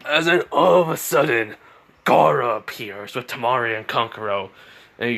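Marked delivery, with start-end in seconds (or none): none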